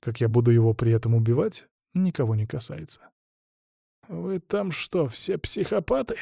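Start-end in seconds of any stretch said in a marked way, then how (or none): 4.1-6.1 slow, soft, high-pitched choked question; strangled wheeze. A narrator's reading. Choked hostage questioning his captors, wheezing. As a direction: force it through a squeezed throat. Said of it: "сдавленно просипел он".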